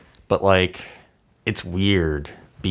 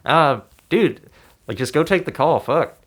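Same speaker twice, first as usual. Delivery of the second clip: imitating Chappelle; 7 semitones higher than usual. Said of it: "he's like".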